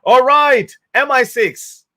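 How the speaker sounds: high, loud, hammed-up officer springing into action; mock eager police officer. Vlogger imagining police obeying the troll, in a hammed-up high voice. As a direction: play a gung-ho cop calling in every agency. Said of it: "would be like".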